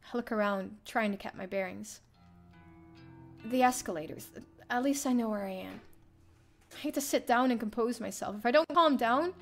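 ordinary talk, nothing marked

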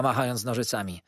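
usual speaking voice

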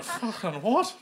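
high, incredulous question; mock disbelief. Host reacting to Easter answer, mock disbelief. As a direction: act out baffled disbelief. Acts out baffled reaction to crowd.

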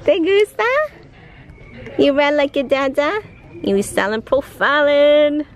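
high, playful cooing. Real, not acted. Mother asking the baby if he likes the outfit, cooing baby talk.

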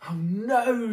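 loud, high frustrated "oh no"; exasperated groan. Acted. Mister Bear woken by snoring, loud exasperated cry. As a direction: cry out at yet another noise.

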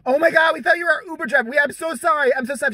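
high, loud, rushed gush of apology; flustered apology. Retold or imitated. Woman apologises to a stranger she mistook for her Uber, flustered. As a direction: imitate a mortified woman apologising fast and high.

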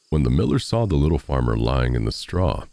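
ordinary delivery

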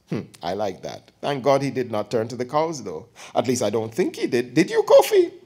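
put-on chatty elderly aunt teasing her nephew; old aunt voice. Aunt teasing her nephew before his girlfriend, lightly voiced old woman.

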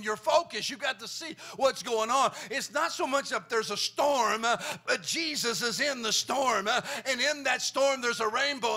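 loud rhythmic whooping preacher; full-force gospel whoop; preaching at full force. Acted. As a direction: ring it out to the congregation with a gasped uh.

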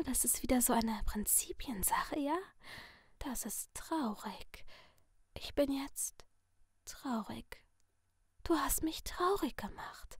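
sinister masked figure in a soft whisper; menacing whisper from behind a mask; creepy whisper. Acted. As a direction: purr the threat like a friendly killer. Letty whispers mock sadness.

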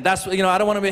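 normal talking voice

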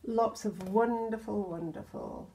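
ordinary delivery

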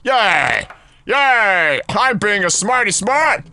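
ordinary talk, nothing marked

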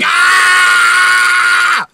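long, high, loud scream of pain; sustained hammed-up shriek; mock scream. Imitating the fighter's victim, exaggerated high scream. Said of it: "touch their arm and they're like".

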